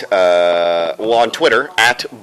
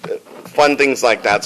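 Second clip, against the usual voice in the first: usual speaking voice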